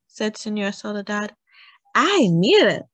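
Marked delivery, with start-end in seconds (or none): 1.9-2.9 louder, high cry of alarm. A narrator's reading. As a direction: cry out to make everyone look. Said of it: "look, cried Nina".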